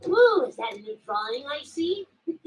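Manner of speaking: normal talking voice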